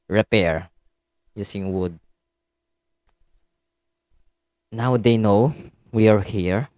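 low, soft muttering to himself; quiet muttering. Real, not acted. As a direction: keep it low and hushed. Quiet game commentary.

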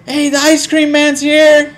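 put-on high, bright call to a little kid; excited calling out. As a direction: lure him back with excitement. Luring kid back with treats.

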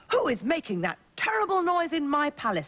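high-pitched indignant outburst; cross, high-voiced royal complaint; angry king. A narrator's reading. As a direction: demand it like an outraged storybook king. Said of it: "the king asked angrily".

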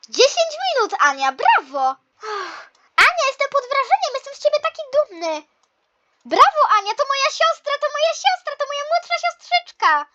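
high, loud, beaming praise; proud cheering. Acted. Proud sibling cheers the younger sister's ten minutes, excited high voice.

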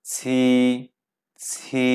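ছি with an aspirated palatal ch, Chinese qi; Mandarin qi sound. Shown as a demonstration. Teacher models the Chinese q sound. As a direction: breathe out hard on ছি. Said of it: "কিউ এর উচ্চারণ আমি কি পড়েছিলাম ছয়ের মতো".

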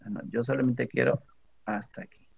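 soft, drifting quiet answer; muttered pretend reply to the program; hushed mock reply. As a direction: say it softly, as if half to yourself. User's reply to software.

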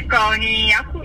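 ordinary talk

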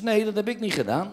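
high put-on woman's voice; mock wife voice. Comic voicing his wife to him, high woman's voice.

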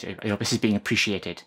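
ordinary delivery

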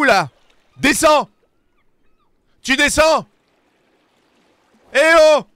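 crie bien plus haut et plus fort, hors de lui; engueulade furieuse. Real, not acted. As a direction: gueule sur l'oiseau pour qu'il descende. Engueule l'oiseau qui l'a fait tomber. (hurle sur la cigogne qui l'a fait tomber)

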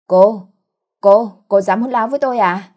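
loud, stammered-out outrage; outraged. A narrator's reading. Mother-in-law affronted by the accusation, loudly outraged. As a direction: burst out at the insolence.

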